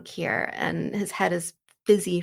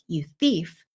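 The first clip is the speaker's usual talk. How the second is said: trying to sound stern. Retold or imitated. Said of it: "he's trying really hard to sound stern".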